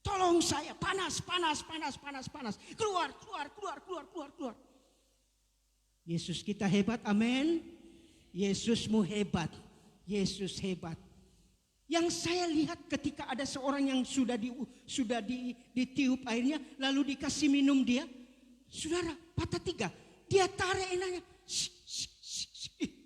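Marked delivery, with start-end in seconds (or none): 0.0-4.6 high, frantic mock plea fading away; imitated demonic manifestation. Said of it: "dia manifestasi di situ". 21.4-22.7 soft repeated hissed "shh"; imitated pulling noise. Imitating pulling on broken arm.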